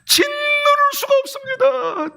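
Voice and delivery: wailing lament. Acted. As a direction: stretch it out as if weeping uncontrollably. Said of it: "통곡할 수밖에 없습니다". (통곡하듯 길게)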